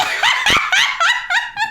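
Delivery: high, loud burst of laughter; squealing laugh; real cackle. Real, not acted. Cracks up at the story.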